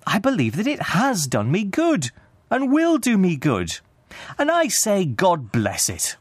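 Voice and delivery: high, swooping heartfelt speech; earnest cheerful nephew. A narrator's reading. Nephew defends Christmas to his uncle, fervent and high. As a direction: build fervently to a slowed last blessing.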